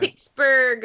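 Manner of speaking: Pittsburgh accent, saying "Pittsburgh" as "Picksburgh"; slow Pittsburgh-accent demo, "Pittsburgh" with k for the t. Shown as a demonstration. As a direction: demonstrate the Pittsburgh accent with a k sound in "Pittsburgh". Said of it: "What's the Pittsburgh accent?".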